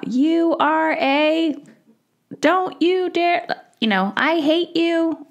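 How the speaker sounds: high, slow mock child's tantrum; imitating an angry child. Mother imitates her child lashing out, high and slow. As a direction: play the furious kid, drawn out and high. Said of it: "a child is projecting their anger onto you".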